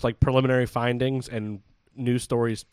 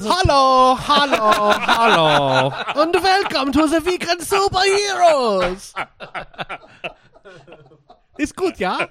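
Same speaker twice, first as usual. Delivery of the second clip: high, loud, drawn-out Austrian greeting; mock Austrian accent; 10 semitones higher, 4 dB louder, much slower than usual. Co-host jumps in to do the Austrian voice, hammed-up accent. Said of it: "[name], can you do a".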